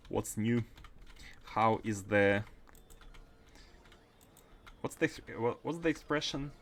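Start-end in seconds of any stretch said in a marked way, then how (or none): none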